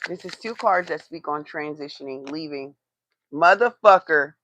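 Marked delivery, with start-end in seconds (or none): 3.3-4.3 loud, exasperated swear at the card; frustrated curse. Real, not acted. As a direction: blurt it out in frustration.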